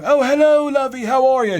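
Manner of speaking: very high, loud, effusive British greeting; gushing older British lady, hammed up; normal Joan, British accent. British woman greeting returning worker, delighted. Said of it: "I wasn't greeted with the normal Joan".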